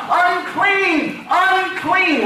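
high, drawn-out holler; hollered warning. Acted. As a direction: call out a warning to passers-by. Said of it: "they had to holler out".

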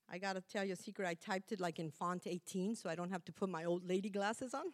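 ordinary delivery

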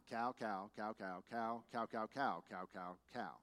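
low, soft monotone repetition; indifferent droning. Acted. Mimics own indifferent thought at herd.

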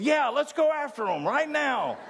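the crowd bursting to charge; excited cheer. Retold or imitated. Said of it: "they all said".

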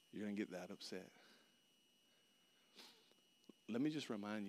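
low, hushed, measured reproof; soft and low, with long pauses; quietly chide, voicing God's correction; the Lord's gentle rebuke. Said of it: "I'm just kinda saying it this way".

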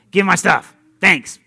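loud, curt demand; rude customer. Retold or imitated. Preacher mocks rude drive-thru customers, loud and curt. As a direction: bark the order like an impatient customer. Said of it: "it's just like".

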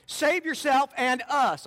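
loud, high, slow demanding jeer; angry taunt. Acted. Criminal on the cross hurling abuse at Jesus, loud and angry. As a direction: hurl it at him, drawn out. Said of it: "hurling abuse at him".

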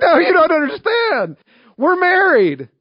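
very high, loud mock wail; hammed-up tearful protest, wailed high; imitated crying outburst. Crying couple correcting the pastor, hammed-up wail. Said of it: "crying uncontrollably".

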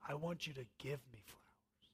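whispered wish for real flowers. Retold or imitated. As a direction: breathe it with no voice, as the wife pleading. Wife voiced, heartfelt wish.

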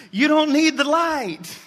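a mother soothing with a laugh, pitched high; caring mom. Retold or imitated. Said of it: "being very caring".

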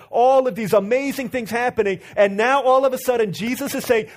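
high, loud, building sermon delivery; fiery preaching. Acted. Preacher to congregation, rising fiery sermon.

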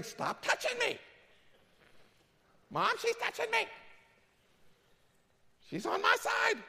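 put-on high whiny kid complaining; whiny tattling little sibling. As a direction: tattle to mom like a bratty kid. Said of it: "your kids start in on this thing".

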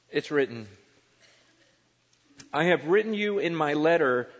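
loud, emphatic pulpit reading of scripture. Acted. Pastor reading First Corinthians aloud to his congregation, booming and emphatic. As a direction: proclaim the verses to the whole room.

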